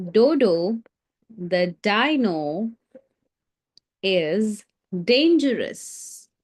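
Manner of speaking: each D sound dragged out slowly; slow stressed alliteration. Shown as a demonstration. Teacher to class, slowly hitting every D. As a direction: spell out Dodo the dino is dangerous, lingering on every D. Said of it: "what did you notice about the sentence".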